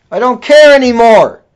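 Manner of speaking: real anger bursting out loud, stress on care; angry outburst. Real, not acted. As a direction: shout it out of real fury. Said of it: "that's how angry I am".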